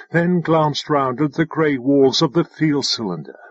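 ordinary delivery, nothing marked

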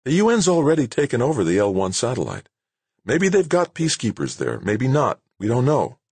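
high-pitched, insistent director laying out the danger; urgent character voice, pitched well up; exasperated base director. Acted. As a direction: argue back as an official under pressure. Narrator voices director arguing back.

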